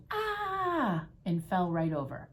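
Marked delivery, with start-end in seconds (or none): 0.1-1.1 long, high-pitched frightened "ah"; performed scream of surprise; startled yell. A narrator's reading. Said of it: "His father yelled".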